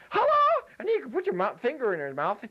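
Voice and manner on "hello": very high squeaky puppet voice, louder; Daisy puppet voice. Pretend.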